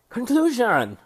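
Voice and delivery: high, loud announcer call of the section title; announced heading. Real, not acted. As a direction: belt it out like a game show host. Announcing the review's final section.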